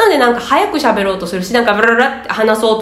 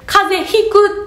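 slow, higher example phrase; slow model phrase; 10 semitones higher, much slower than usual. Shown as a demonstration. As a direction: lift and stretch the example phrase. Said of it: "っていう".

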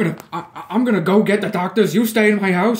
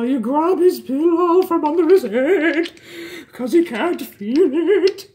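high, very slow, drawn-out words; slow haunting figment voice; eerie instructing; 5 semitones higher, slower than usual. Acted. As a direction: coax them on like a phantom. Figment instructs Bonnie to kill.

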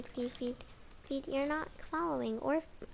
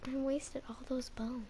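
breathy regret; whisper; 8 dB quieter than usual. Real, not acted. To viewers, a regretful whisper. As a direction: hush it out.

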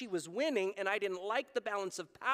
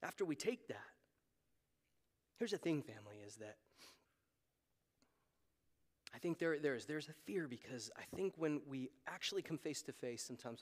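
low, soft, intimate sermon voice; hushed confiding preaching; 9 semitones lower, 8 dB quieter, faster than usual. Real, not acted. Preacher confiding a fear to church family, hushed. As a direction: drop low and speak gently to the congregation.

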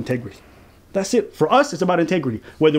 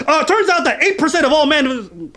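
high-pitched mocking recital; mock stats-reciting man; 8 semitones higher, 3 dB louder than usual. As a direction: blurt statistics in a raised, silly voice. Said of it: "with all these stats and numbers".

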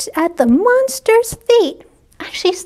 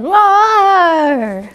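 monster roar; 2 semitones higher than usual. Acted. Posy in a monster costume roaring at Pip, mock roar. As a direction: roar like a little girl pretending to be a monster. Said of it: "And she said".

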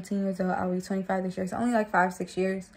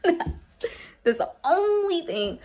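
laughing; 9 semitones higher than usual. Real, not acted. Laughing while explaining.

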